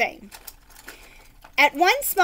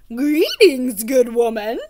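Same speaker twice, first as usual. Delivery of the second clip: knight greeting; 7 dB louder, slower than usual. Acted. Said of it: "said Sir Fartsalot".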